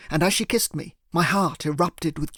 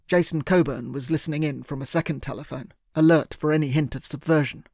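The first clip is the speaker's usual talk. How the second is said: plain reading voice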